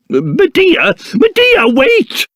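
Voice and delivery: questioning call jumping to high alarm. Acted. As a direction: call after them in rising panic. Calls after Medea as she leaves.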